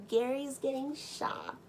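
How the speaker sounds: mock crowd gasp. Classmates voiced gasping that Gary gets shot. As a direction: tease it like a gossiping crowd.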